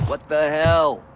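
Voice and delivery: shocked outburst. Acted. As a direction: explode in outraged disbelief. Shocked outburst after gun incident.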